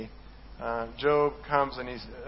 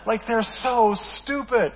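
exasperated; 7 semitones higher, 6 dB louder, slower than usual. Real, not acted. Exasperated at the birds.

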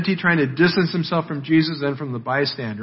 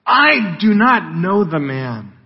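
"I do not know the man" slow and weighty, "I" stressed; sworn denial; 3 semitones higher, slower than usual. Acted. Peter denying Jesus with an oath, "I do not know the man" slow and emphatic. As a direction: swear "I do not know the man" word by word, slowly. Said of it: "this time with an oath".

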